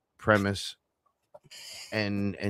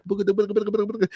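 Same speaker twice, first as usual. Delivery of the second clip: much higher rapid booga babble; mock language babble; 12 semitones higher, much faster than usual. Imitating cousin spitting fluent Spanish, high rapid babble. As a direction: rattle off high-pitched nonsense syllables.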